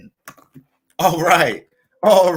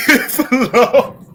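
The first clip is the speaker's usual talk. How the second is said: breaking into a laugh mid-line; can't keep a straight face; 4 semitones higher, slower than usual. Real, not acted. Streamer laughing at own claim of a short stream. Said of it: "I can't even say that shit with a straight face".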